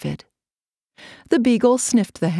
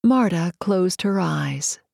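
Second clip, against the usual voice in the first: usual speaking voice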